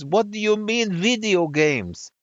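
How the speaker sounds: outraged French woman, high and drawn out; scandalized French interviewer demanding an answer; mock scream. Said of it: "screams at me".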